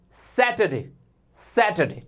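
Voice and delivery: "सैटरडे" as satuhday, r silent; no r sound. Shown as a demonstration. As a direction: let the r vanish in "सैटरडे". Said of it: "आर नहीं बोला है".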